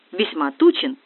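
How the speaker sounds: usual speaking voice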